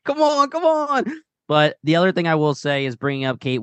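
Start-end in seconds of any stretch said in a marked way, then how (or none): 0.0-1.0 high, strained come on come on; impatient pleading. Retold or imitated. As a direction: beg the scene to arrive. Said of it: "So we were just like".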